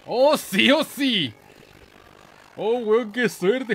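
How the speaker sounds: loud, high whoops of delight; overjoyed gamer yelling; excited cheer. Real, not acted. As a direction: celebrate the lucky break out loud. Lucky win in race game.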